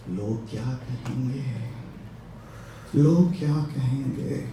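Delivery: low, slow, hushed lament; mock disappointed elder. Disappointed Pakistani fretting over what people will say, low and slow. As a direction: drag it out softly, heavy with disapproval.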